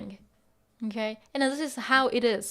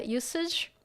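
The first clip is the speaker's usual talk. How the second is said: normal talking voice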